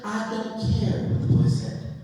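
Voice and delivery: indignant mother. Retold or imitated. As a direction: imitate a mother cutting off excuses. Mother cuts off the principal.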